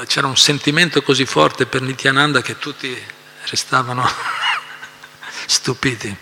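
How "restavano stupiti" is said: ordinary talk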